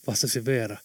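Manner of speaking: usual speaking voice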